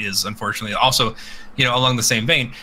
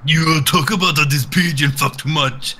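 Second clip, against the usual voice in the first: mock broken English; 4 semitones higher, 4 dB louder than usual. Mocking a broken-English pigeon quote.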